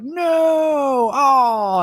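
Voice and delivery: long high drawn-out groan of dismay; imitating a crowd's loud letdown; mock audience groan. Audience groans as baby cries.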